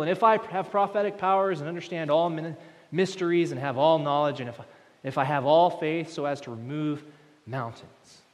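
loud, rising sermon voice; preaching at full force. Acted. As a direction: build the list to a climax. Preaching builds.

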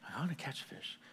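very soft, nearly whispered answer; meek reply. Acted. Learner giving in to the fishing mentor, meek and hushed. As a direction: give in under your breath.

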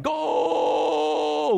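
high, long-held mock cheer; imitated crowd yell. Crowd at the football game, imitated yell. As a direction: stretch it out on one high note. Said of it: "whatever the crowd's yelling".

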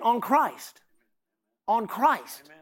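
"Christ" drawn out with a firm fall; stress on Christ. Shown as a demonstration. Preacher drives the point home to church, stressing "Christ". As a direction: lean on "Christ" each time. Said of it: "Put it on Christ".

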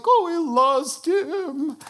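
high, loud, drawn-out mourners' wail; hammed-up group lament, stretched slowly; mock wailing. Everybody at Lazarus's memorial lamenting, mock wail. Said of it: "And everybody's like".

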